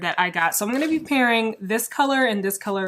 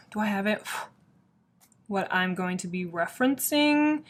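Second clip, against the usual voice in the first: ordinary delivery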